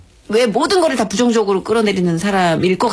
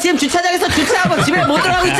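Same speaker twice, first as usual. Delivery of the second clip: high-pitched hammed-up scolding; mock impatient demand; 11 semitones higher than usual. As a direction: play an irate listener hurrying the hosts. Acts out impatient listener in car. (다그치는 척 과장되게 높은 목소리)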